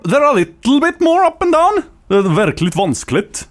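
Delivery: very high Norwegian lilt, then dropping; up-and-down Norwegian accent. Shown as a demonstration. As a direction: demonstrate singsong Norwegian, stretched out. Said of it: "They're kinda that kinda sound".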